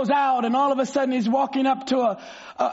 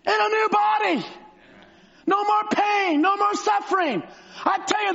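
preaching at full force; 5 semitones higher than usual. Acted. Preacher lifts the church with Branham's heaven vision, fervent shout. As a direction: hammer the hope home to the congregation.

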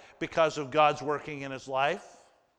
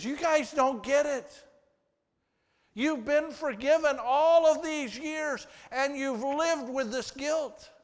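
much higher, louder pleading voice; Joseph pleading; 11 semitones higher, 4 dB louder than usual. Acted. Joseph to his guilt-ridden brothers, high and urgent. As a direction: urge them to finally see they're forgiven.

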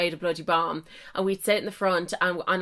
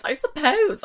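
higher, louder, coy "suppose" with a rising lilt; playful rising "I suppose"; 8 semitones higher, 5 dB louder than usual. Retold or imitated. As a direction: tease it out, coyly giving in. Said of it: "So I'd be like".

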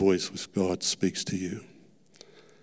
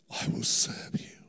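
whispered vow; 5 dB quieter than usual. Retold or imitated. Kneeling, surrendering to God.

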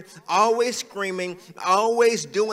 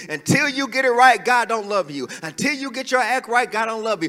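louder, higher, scolding the sinner; harsh condemning preacher; 4 semitones higher, 5 dB louder than usual. Shown as a demonstration. Preacher shows harsh judgment, shouting condemnation. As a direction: hammer them with judgment. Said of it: "how would you feel right now if I told you".

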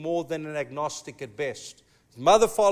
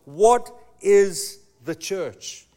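very slow, louder awed question; awed, drawn-out question; 6 dB louder, much slower than usual. Retold or imitated. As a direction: let awe stretch every word. Awed at Rome, asking himself.

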